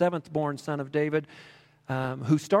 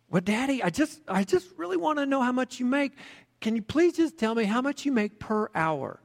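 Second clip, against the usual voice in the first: put-on higher child's voice, begging gently; a kid who won't give up asking; little boy pleading with his tired dad; 9 semitones higher than usual. A narrator's reading.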